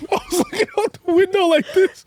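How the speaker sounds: very high comic sobbing through laughter; imitated angry cry. Son crying angrily at the car window, hammed-up high sob. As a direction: squeak it out like a laughing mock cry. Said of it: "that cry when you just, like, angry".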